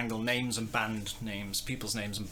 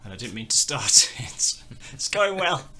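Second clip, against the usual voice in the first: laughing at own blunder; 3 semitones higher, 8 dB louder than usual. Real, not acted. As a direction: laugh helplessly as it goes wrong. Botched start, laughs at own blunder.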